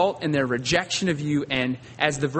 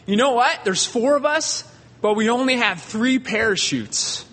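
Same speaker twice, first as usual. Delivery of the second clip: urgent pilot announcement; 5 semitones higher than usual. Retold or imitated. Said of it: "And he said".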